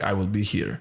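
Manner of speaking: imitated Russian hairdresser accent; thick Russian accent. Hairdresser to departing client, thick Russian accent. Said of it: "very thick Russian accent".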